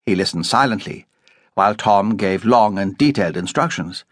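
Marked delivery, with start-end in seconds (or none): none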